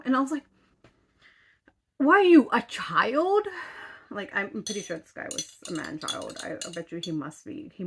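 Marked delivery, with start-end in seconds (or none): 2.0-3.5 high, loud incredulous yell; mocking shout. Mocking the app guy as a man child, shouting. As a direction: throw the insult at him at full volume. Retelling a standoff with her dating-app match.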